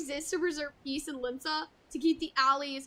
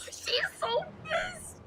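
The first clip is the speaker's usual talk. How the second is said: sharp gasp, then a slow, high squeal; real awe at the angry character; gasping amazement; 13 semitones higher, much slower than usual. Real, not acted. Streamer reacts, gasps.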